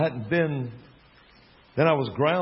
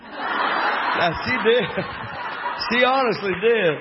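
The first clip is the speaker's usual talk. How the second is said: laughing; 8 semitones higher, 4 dB louder, slower than usual. Real, not acted. Laughing at own punchline.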